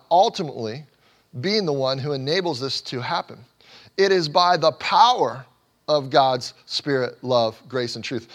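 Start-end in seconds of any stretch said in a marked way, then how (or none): 4.0-7.2 loud, slow, each word weighted; rising preacher's emphasis; preaching emphasis. Acted. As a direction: proclaim it slowly.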